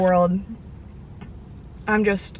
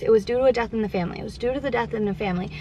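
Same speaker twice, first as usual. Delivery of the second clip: rattled off fast and softer, mocking the repeated excuse; 4 dB quieter, faster than usual. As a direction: parrot their excuse quickly and dismissively.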